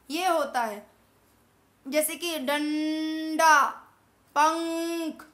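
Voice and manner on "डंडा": डंडा slow, with the nasal ं sounded clearly through the nose; nasal demo. Shown as a demonstration. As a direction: stretch the nasal in डंडा for students. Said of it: "इनको बोलते समय हवा सिर्फ कहाँ से निकल रही है". (डंडा की बिंदी वाली नासिक्य ध्वनि धीरे से)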